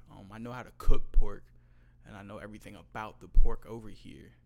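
raises voice proudly to the customer; loud boast. Retold or imitated. To a doubting customer, a loud proud boast. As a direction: belt it out like you own the counter.

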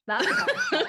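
jokey high-pitched That's harsh; mock high repeat. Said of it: "repeated in put-on high voice".

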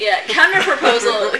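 loud laughing shout of the word. Acted. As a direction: yell it out while laughing. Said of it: "you shout counterproposal".

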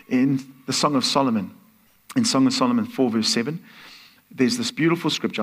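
ordinary talk

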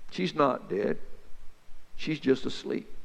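low, soft and sure; calm reassurance. Acted. Jesus calmly telling the crying mourners the girl sleeps, softly. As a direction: hush the mourners gently.

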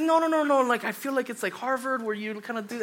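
high, flustered "no, no, no"; retelling his own flustered protest; panicked denial. Retold or imitated. Retold panicked denial to girlfriend.

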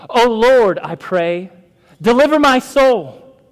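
loud, high plea to God; crying out in prayer. Acted. Psalmist calling on the Lord from distress, loud plea. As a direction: call out for rescue with full voice.

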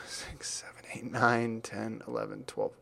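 whispered counting to himself; counting under breath. Real, not acted. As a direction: mutter the count quietly.

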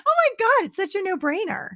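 thrilled, high-pitched realization; giddy relief. Real, not acted. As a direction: marvel in a high voice at how easy it is. Giddy after solving it.

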